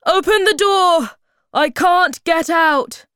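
high, loud cry for help; urgent shouting. A narrator's reading. Moomin Papa stuck behind the door, urgent shout. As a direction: demand to be let out at full voice. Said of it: "shouted Moomin Papa again".

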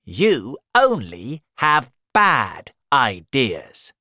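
loud, slow, emphatic rebuke; storybook elephant's cross retort; angry elephant. Acted.

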